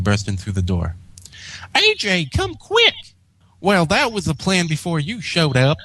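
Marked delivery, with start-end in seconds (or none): 1.8-2.9 high little filly calling out; young filly announcing the mail; Apple Bloom voice. Acted. 3.6-5.8 lightly voiced country mare, wry; dry Applejack.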